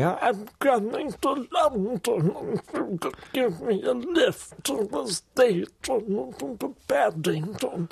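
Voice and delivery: put-on high, slow, frail old lady on the phone; great aunt's voice. Prank-calling his cousin as his old aunt. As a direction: play the aunt asking for a lift. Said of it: "pretending to be her".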